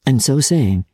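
ordinary delivery, nothing marked

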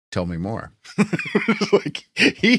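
ordinary talk, nothing marked

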